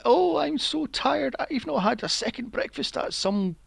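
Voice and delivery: high-pitched, hammed-up moaning; put-on whiny complaint, voice pushed up high; mock whining.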